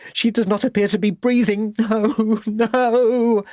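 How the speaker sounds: high rising anguished no; anguished cry. A narrator's reading. Lucy over the still baby, high anguished cry. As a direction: cry out as the baby won't breathe. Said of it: "cried out Lucy".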